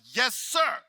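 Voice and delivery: loud, high, instant compliance; obedient shout. Retold or imitated. Speaker to the yelling trainer, obedient shout. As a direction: answer like a scolded recruit.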